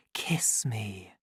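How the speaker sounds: soft, sleepy breathless whisper. A narrator's reading. Lenina to the savage, sleepy whisper. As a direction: sink into a drowsy whisper. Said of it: "let her voice sink into a sleepy whisper".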